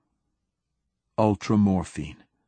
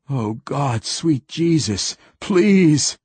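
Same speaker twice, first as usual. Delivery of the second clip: rising from a moan to a loud, high cry on "please"; pain-wracked priest begging for ultramorphine, escalating; desperate pleading; 6 semitones higher, 5 dB louder, slower than usual. Acted. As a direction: build the plea into a scream.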